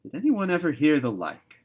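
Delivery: loud, high exasperated wonder; mystified. A narrator's reading. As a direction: she can't believe what she's seeing. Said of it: "the mystified Marilla wanted to know".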